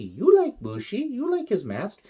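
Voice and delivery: high, cooing pet voice; baby talk to cat. Acted. Talking to his cat producer in high baby talk.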